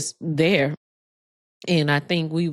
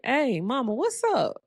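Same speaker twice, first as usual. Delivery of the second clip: playful, much higher teasing hello; joking greeting; 8 semitones higher than usual. Retold or imitated. Joking with her dying mom, a high playful greeting. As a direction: tease mom cheerfully, pitch jumping up. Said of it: "just joking with her".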